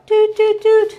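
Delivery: high sing-song doot doot doot; playful doot sounds. Acted. Baker voicing the parchment sliding onto the pie with high playful doots. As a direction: toot like a little conveyor belt. Pulling parchment like conveyor belt.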